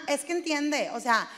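usual speaking voice

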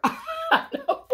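high giggling aside; laughing. Real, not acted. Reading to kids, laughing at Mouse's dance. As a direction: giggle through it.

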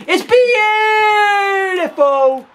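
high, loud praise; high excited yell; admiring shout. Real, not acted. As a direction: cry out in delight. Admiring a goal.

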